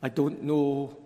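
ordinary talk

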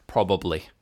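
normal talking voice

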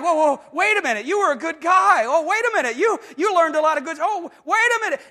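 hurried, alarmed "wait a minute"; alarmed protest. Retold or imitated. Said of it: "And you're like".

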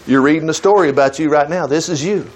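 ordinary talk, nothing marked

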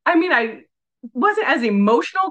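ordinary talk, nothing marked

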